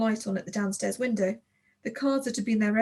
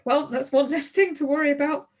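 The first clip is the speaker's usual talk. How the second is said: forced joke; 5 semitones higher, 6 dB louder than usual. A narrator's reading. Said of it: "Tulip tried to joke".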